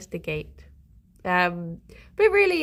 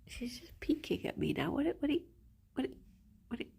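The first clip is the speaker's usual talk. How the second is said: whisper; 4 semitones higher, 12 dB quieter, faster than usual. Real, not acted. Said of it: "bunny peeking, don't startle".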